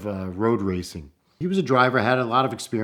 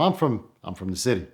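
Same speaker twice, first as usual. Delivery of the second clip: usual speaking voice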